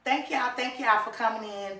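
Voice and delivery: ordinary talk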